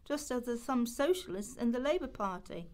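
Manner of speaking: ordinary talk, nothing marked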